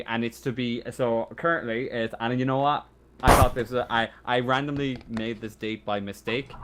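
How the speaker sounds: ordinary delivery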